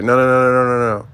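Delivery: loud repeated no's, insisting; emphatic refusal. Retold or imitated. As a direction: shut it down, loudly and fast. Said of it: "And French is like".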